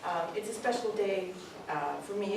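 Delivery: usual speaking voice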